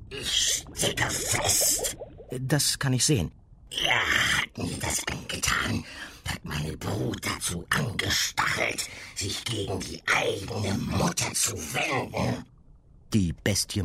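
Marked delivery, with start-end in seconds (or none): 0.1-2.0 slow, hissing creature; monster voice. Acted. 2.4-3.3 put-on higher young girl voice. Girl answers. 3.8-12.5 eerie creature voice; monster voice. Acted.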